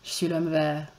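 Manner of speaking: low radio host with a smile; deep smiling radio voice; l'homme vert. Acted. A lover introducing himself, low smiling radio voice. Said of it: "voix de Radio F I P plus grave avec un sourire".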